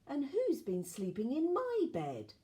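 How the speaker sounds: high, light mother bear voice. A narrator's reading. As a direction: raise the pitch without roaring. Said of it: "said in a quieter voice".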